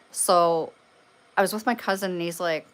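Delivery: ordinary delivery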